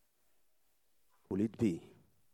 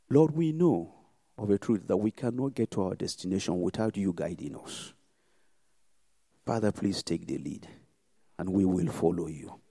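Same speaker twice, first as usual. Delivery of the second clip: solemn prayer; 2 semitones lower, 3 dB louder than usual. Real, not acted. Closing prayer over congregation.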